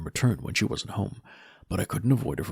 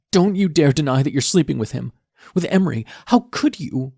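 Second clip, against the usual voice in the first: accusing daughter; 7 semitones higher, 7 dB louder than usual. Acted. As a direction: spit out the accusation.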